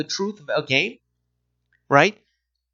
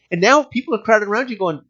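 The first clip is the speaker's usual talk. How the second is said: ordinary talk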